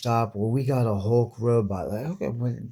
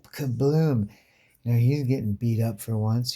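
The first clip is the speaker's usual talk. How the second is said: usual speaking voice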